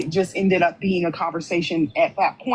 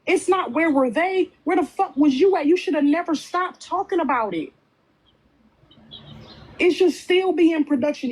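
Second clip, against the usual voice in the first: rising, higher-pitched furious demands; real angry rant; 6 semitones higher than usual. Real, not acted. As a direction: tear into her, voice climbing. Heated rant at Cascade.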